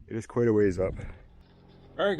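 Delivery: ordinary talk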